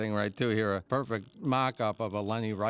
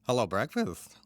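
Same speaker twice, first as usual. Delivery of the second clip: higher, quicker cartoon duck inflection; Daffy; 4 semitones higher than usual. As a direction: lightly imitate a cartoon duck, lifting the pitch. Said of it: "say it with a different inflection, different intonation".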